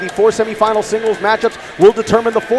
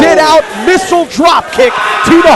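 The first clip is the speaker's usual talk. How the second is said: yelling over a big spot; excited shout; 7 semitones higher, 7 dB louder, slower than usual. Real, not acted. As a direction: erupt at the big move. Big move, commentator yells.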